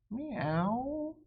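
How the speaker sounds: high rising cat mew; cat meow. Retold or imitated. Cat meowing in dark hallway.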